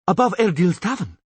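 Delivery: high, loud pointing call; shouted. Acted. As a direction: call it out to the others. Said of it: "shouted Karayadan".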